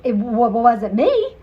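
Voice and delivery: loud flustered stammering with laughs; stammering nervous Monty; nervous stutter. Acted. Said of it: "Monty stuttered".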